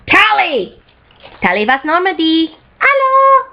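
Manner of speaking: badly faked Russian-tinged Tali accent, high hello; attempted Tali accent. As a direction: put on a rough Slavic alien accent, high-pitched. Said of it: "I cannot do her accent".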